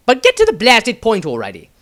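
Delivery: fed-up shout at the zealot; shrill impatient outburst, tacked-on apology; agitated. A narrator's reading. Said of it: "Maestro was agitated".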